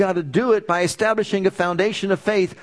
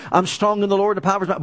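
fast repeat; much faster than usual. Shown as a demonstration. Preacher repeats the confession for the congregation, fast. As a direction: rattle it off fast, same words again. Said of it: "we should be going around saying".

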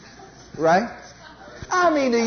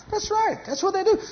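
high sarcastic affirmation; 11 semitones higher, 3 dB quieter than usual. Real, not acted.

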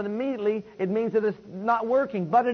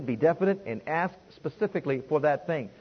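ordinary delivery

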